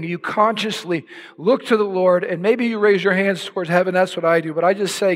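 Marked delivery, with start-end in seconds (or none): none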